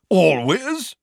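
high, loud booming question. A narrator's reading. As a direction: boom it out in disbelief. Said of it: "boomed Madam Bones".

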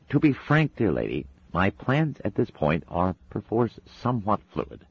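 a hint of a polite, prissy angel talking through Madam Tracy; faint prim Englishman's voice from the next room; prim southern gentleman. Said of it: "in the voice of the demon".

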